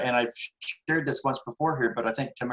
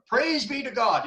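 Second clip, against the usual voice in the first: fervent praise; 8 semitones higher than usual. Retold or imitated. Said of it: "she would always get up and say".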